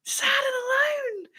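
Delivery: high, slow fake crying wail; hammed-up weeping; mock sobbing. Mock crying over own fate.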